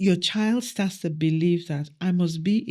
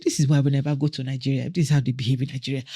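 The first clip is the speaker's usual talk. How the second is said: fast, louder rushed explanation; 4 semitones lower, 3 dB louder, much faster than usual. Retold or imitated. Daughter explaining to her friend, fast and loud. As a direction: rattle it off in a rush. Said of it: "she said".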